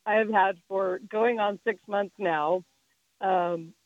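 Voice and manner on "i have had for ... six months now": normal talking voice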